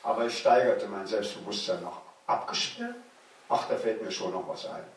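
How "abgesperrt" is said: very high, incredulous scoff; scoffing echo. Acted. Boy scoffing at the closed platform, incredulous. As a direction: toss the word back in disbelief.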